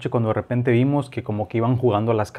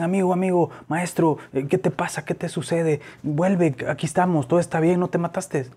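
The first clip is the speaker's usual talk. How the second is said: higher, anxious coaxing voice; a trucker coaxing a dazed driver back, higher and anxious; worried pleading; 7 semitones higher than usual. Retold or imitated. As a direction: plead with him to come back to himself. Truckers trying to wake shocked driver.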